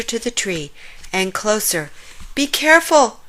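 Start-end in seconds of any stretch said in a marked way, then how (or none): 2.3-3.2 loud, higher warning call. A narrator's reading. Warthog warning friend approaching the tree, calling loudly. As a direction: call out a warning across the dark. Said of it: "called Warthog".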